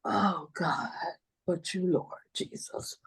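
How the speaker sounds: breathless whisper to God through tears; whispered prayer. Real, not acted. Grandmother in court whispering to God, tearful whisper. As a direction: whisper a plea to the Lord, barely voiced.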